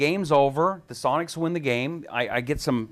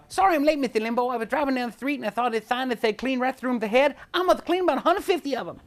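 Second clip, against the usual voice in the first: much higher, lisping boxer voice; Mike Tyson impression; 12 semitones higher than usual. Tyson impression on Rush's show, hammed-up. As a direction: keep the Tyson bit going, joking. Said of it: "stupid Mike Tyson impression".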